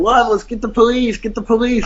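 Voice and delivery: high-pitched mock cry for help; a frantic wife's plea, imitated at high pitch; imitated screaming. Attacked wife calling to her husband's friend, mock screaming. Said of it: "then she's screaming".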